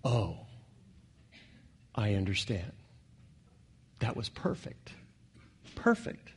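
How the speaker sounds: soft, hushed realisation, fading to a whisper; awed hush. Retold or imitated. Believer in heaven finally understanding God, hushed awe fading to whisper. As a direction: breathe it out in quiet wonder. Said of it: "You'll go".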